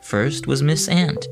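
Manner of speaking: usual speaking voice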